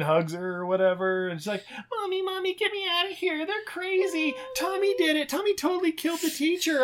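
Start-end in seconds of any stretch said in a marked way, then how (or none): 1.9-6.8 put-on high childish voice; little girl pleading. The girl begging her mom, in a high child voice. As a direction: plead like a scared small girl.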